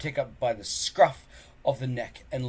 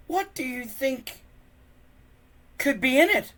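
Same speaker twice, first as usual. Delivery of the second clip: much higher, curious question; curious Fishlegs; 8 dB louder than usual. Acted. Friend asking Hiccup about the coffin, high curious. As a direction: wonder nervously.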